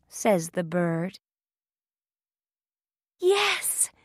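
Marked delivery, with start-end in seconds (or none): 3.2-3.9 very high little heroine voice; delighted cry. A narrator's reading. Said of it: "cries Thumbelina".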